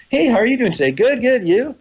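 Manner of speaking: high, bright put-on cheer; fake cheerful greeting. Hiding anger from a stranger in the elevator, fake cheerful. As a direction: paste on a chirpy smile and greet.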